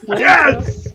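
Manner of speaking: sudden high cheer; excited. Real, not acted. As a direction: burst out in delight. Cheering the Wayne's World joke.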